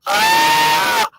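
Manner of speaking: shrill piercing yell, very high and loud; imitated high scream. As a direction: shriek like the man in the footage. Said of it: "when he said".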